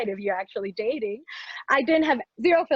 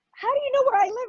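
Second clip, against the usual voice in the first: much higher, louder burst of disbelief; shocked; 12 semitones higher, 4 dB louder, faster than usual. Retold or imitated. Startled at the pushy suitor knowing her address, high alarmed.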